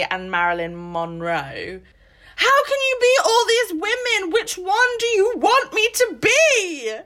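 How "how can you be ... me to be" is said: mock indignant. Host mocking a character's impossible beauty description, exasperated. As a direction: voice the woman confused by her description, exasperated.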